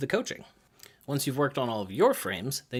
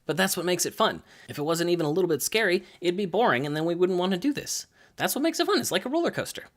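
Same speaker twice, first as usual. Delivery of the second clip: building to a high, thrilled pitch; pep up a scared athlete, rising higher; acted excitement; 5 semitones higher, 3 dB louder, faster than usual. Coach selling fear as fun.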